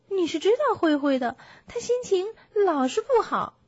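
high-pitched bear voice; kindly little bear, concerned. Acted. Bear tells piglet about the sad donkey, concerned. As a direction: share concern in a high, earnest voice.

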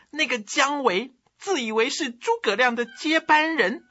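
high, sly courtier slandering a rival; scheming eunuch voice. Acted. 皇后向阿斗进谗言. (尖声谄媚的宦官腔)

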